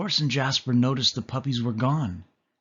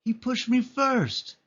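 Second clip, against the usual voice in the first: high, slow whiny pup; little puppy whining back; 13 semitones higher, slower than usual. A narrator's reading. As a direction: protest like a sulky little puppy. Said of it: "Patch whined".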